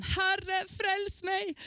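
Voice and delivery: high desperate call; cry for help. A narrator's reading. Peter sinking in the storm: cries for help. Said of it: "Og da ropte han".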